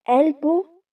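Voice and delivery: ordinary delivery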